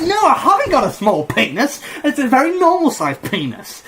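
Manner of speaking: high, squawking self-defence; comically wounded denial; mock indignant protest. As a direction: protest far too much, pitch way up. Protesting the crowd's jeer.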